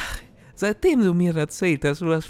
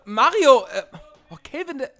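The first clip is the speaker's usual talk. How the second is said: panicked; 7 semitones higher, faster than usual. Acted. Pepe panics as Mario leaves.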